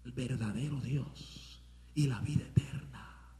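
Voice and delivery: slow, hushed whisper; whispered solemn declaration. Real, not acted. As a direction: let it drop to a breath. Sudden whisper in sermon.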